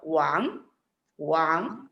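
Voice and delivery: "Wang" starting low and sliding up; rising second tone. Shown as a demonstration. Teacher shows tone two to pupils, "Wang" rising. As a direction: lift the end of each "Wang" upward.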